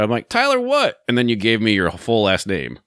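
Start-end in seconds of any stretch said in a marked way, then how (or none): 0.3-1.0 much higher, silly put-on prank-call voice; a goofy disguised voice to fool the caller; stupid voice. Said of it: "I put on some stupid voice".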